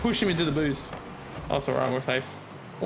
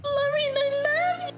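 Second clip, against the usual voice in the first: very high, falsetto declaration; high falsetto love call to Lauren, teasing; mock sweet voice; slower than usual. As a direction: squeak it out like a lovesick joke.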